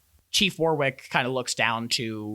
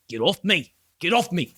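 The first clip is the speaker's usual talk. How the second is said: raised, higher mock protest; protesting captive; 5 semitones higher, 4 dB louder than usual. Captured crook held in someone's arms, mildly agitated protest. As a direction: wriggle against someone's grip as you speak.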